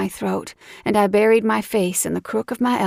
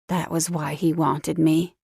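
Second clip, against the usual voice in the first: ordinary delivery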